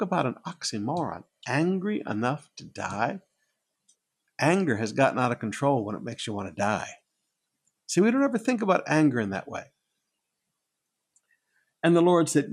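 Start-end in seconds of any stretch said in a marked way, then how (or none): none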